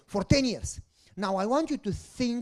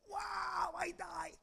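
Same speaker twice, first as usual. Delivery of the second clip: mock dying gasp; 10 semitones higher, 11 dB quieter, much slower than usual. Retold reaction to the desert heat.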